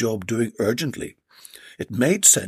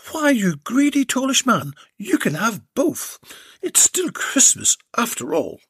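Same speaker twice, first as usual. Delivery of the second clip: high, saucy lady; a playful indulgent tease; Belinda's voice; 10 semitones higher than usual. Acted. Belinda granting Jed's wish, higher saucy voice.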